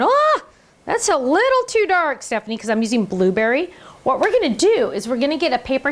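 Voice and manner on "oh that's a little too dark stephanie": high, bright mock gasp at the dark ink; mock viewer dismay. Viewers react to her too-dark stamp, mock dismay. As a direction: play the startled viewer teasing her. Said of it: "We're, like, going".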